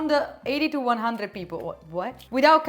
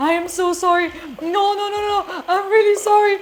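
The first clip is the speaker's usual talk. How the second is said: higher, louder string of frantic nos; panicking apology; 7 semitones higher, 9 dB louder, faster than usual. Retold or imitated. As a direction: beg forgiveness in a rush. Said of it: "he started panicking like".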